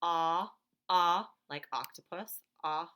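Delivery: isolated "Ah" sounds, held; short a sound. Shown as a demonstration. Teacher sounding out "ah" for students. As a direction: sound out "ah" on its own, like octopus. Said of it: "Here's what I hear".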